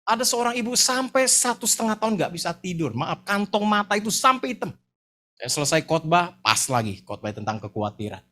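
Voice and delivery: ordinary talk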